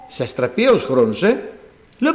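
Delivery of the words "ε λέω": ordinary talk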